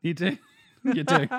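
high, loud laughing talk. Real, not acted. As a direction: crack up through the words. Laughing banter about robots.

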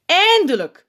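high, loud relieved call. A narrator's reading. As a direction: shout it into the mic. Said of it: "roept Sint in de microfoon".

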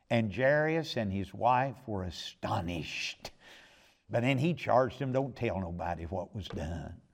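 hushed preaching. Acted. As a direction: draw them in with a hushed, reverent telling. Hushed telling of the miracle.

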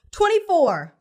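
put-on loud, high kid shout; eager blurted answer. A narrator's reading. Pupil answering the math question wrongly, loud eager shout. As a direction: blurt it out proudly.

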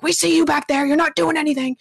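scolding bosses. Retold or imitated. Owners phoning staff hiding in the back, mock scolding. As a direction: nag like a micromanager watching the cameras.

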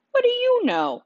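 high, loud angry snap; sharp, high and loud retort; snapped. A narrator's reading. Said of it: "I snapped".

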